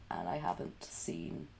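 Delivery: whisper. Real, not acted. Confessing loneliness.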